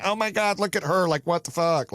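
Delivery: high-pitched, scandalized gossip voice; mocked jealous onlooker. Onlookers judging a woman walking by, scandalized. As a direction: imitate someone judging a passerby. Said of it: "people walk by".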